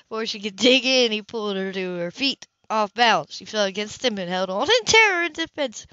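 usual speaking voice

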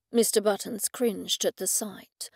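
ordinary delivery

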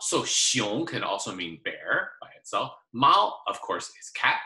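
ordinary talk, nothing marked

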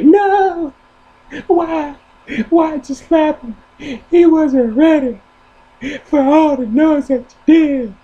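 very high, loud shrieking mother; his mama. Retold or imitated. Mama scolding the slapper in a shrieking voice. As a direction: shriek it like an outraged mom. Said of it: "your mama's gonna say".

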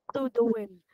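ordinary delivery, nothing marked

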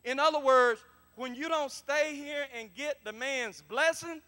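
loud, high, fired-up preaching; full-force preaching. Acted. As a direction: preach it with everything.

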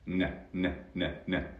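short, soft repeated "N" sounds; isolated n sound. Shown as a demonstration. Teacher isolates the final consonant for learners, short repeated "N" sounds. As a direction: tap out "N" as separate nasal sounds. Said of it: "por la N se vuelve más nasal".

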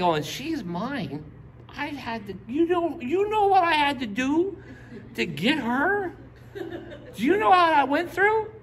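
high, rising protest with a laugh; David pleading his case, voice climbing; David indignant. Retold or imitated. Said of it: "David was like".

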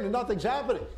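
ordinary delivery

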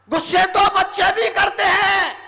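high, forceful preacher's shout; ringing high-pitched repeat; loud proclamation. Shown as a demonstration. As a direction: proclaim it loudly to the crowd.

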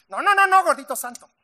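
loud, high string of no's; emphatic indignant no no no no; angry refusal. Acted. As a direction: shout him down with each no. Wife refuses husband's invitation.

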